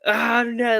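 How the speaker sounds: high, loud, yelped mock protest; mock panicked Sheed. Sheed losing his mind at the foul call, high yelping protest. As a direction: squeal it like a player losing it over a foul. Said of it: "and he's just like".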